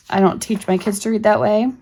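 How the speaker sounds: ordinary talk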